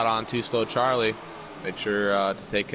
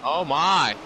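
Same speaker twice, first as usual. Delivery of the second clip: surprised; 6 semitones higher, 4 dB louder than usual. Real, not acted. Lap car blocks the leaders.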